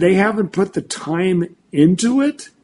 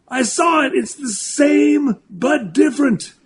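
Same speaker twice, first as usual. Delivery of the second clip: much higher, awestruck, building to a shout; amazed mentor; 10 semitones higher than usual. Retold or imitated. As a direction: play the mentor who suddenly sees the pattern, rising to a shout. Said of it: "he goes".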